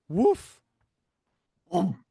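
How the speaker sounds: loud acted "oof" on landing; short, punchy grunts as if hitting the ground; impact grunt. Acting impact grunts in comic.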